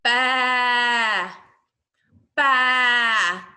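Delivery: loud, open drawn-out baa; sustained baa with a falling end; sheep baa. Shown as a demonstration. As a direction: call out a long baa. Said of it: "make the same sound as me".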